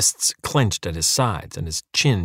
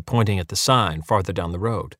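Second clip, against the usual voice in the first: plain reading voice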